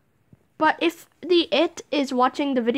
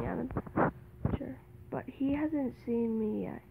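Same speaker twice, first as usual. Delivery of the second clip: whispering while hiding; 16 dB quieter, slower than usual. Real, not acted. Hiding as seeker nears.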